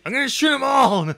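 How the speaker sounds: playful hammed-up menace; trigger-happy contractor. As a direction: ham up the gun-crazy thug. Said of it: "He's just like".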